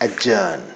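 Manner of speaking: adjourn said "a-jurn", the d silent; adjourn without the d sound; correct pronunciation. Shown as a demonstration. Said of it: "അപ്പൊ adjourn എന്നല്ല". (adjourn ഡി ഉച്ചരിക്കാതെ)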